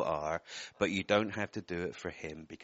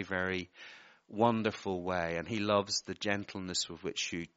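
normal talking voice

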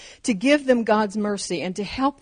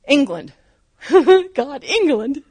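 giddy, higher-pitched longing with a laugh; excited; 5 semitones higher, 6 dB louder than usual. Retold or imitated. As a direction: exclaim it with an excited giggle. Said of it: "I would get so excited".

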